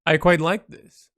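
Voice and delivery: ordinary talk, nothing marked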